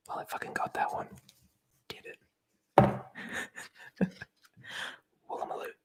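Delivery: ordinary delivery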